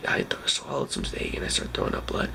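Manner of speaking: strained, breathless whisper; forcing the words out in a pained whisper; barely able to talk. Retold or imitated. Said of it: "I could barely talk".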